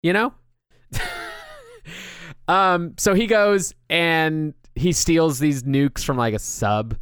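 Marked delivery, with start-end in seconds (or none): 0.9-1.5 a sudden high squeal of a laugh; squeaky laugh. Real, not acted. Host cracking up at her own joke, high squeaky laugh. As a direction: let a laugh jump way up and squeak out.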